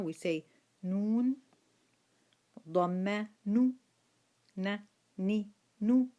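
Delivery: نو with a long u, and ني and نو set side by side; syllable drill. Shown as a demonstration. Teacher reciting the dhamma syllables to the class: نو. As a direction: call out نو, then ني, then نو again.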